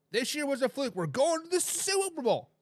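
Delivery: high-pitched mock excitement; hammed-up fan boast; excited fans. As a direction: imitate hyped-up fans, pitch climbing. Acting out overconfident Lions fans.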